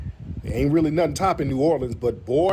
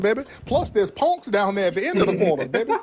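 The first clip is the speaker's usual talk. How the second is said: offended New Orleans local; 6 semitones higher than usual. Retold or imitated. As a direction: scold like an offended New Orleans auntie, high and stretched. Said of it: "just tell 'em you gonna eat in the French Quarters".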